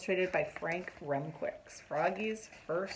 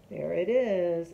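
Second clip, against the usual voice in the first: ordinary delivery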